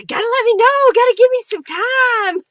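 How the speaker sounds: high, loud playful holler; mock scolding. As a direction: jokingly yell at a friend for short notice. Mock scolding viewer for late request.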